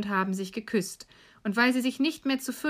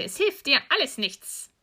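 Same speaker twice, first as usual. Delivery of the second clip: high, dismissive witch voice; scornful old witch; 5 semitones higher, 3 dB louder than usual. Acted. Old witch to weeping Gretel, scornful dismissal. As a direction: dismiss her pleas in a raised voice. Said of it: "sagte die Alte".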